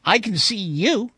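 high, stumbling, hasty explanation; flustered. A narrator's reading. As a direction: fumble through an apology to a child. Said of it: "I said quickly".

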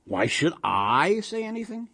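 put-on high, put-upon old husband; Yiddish-inflected shrug in the voice; rhetorical question to air. A narrator's reading. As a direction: ask nobody in particular, pitch climbing. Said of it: "he asked the air".

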